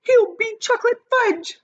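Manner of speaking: high, loud despairing cry. A narrator's reading. Mother insisting in despair.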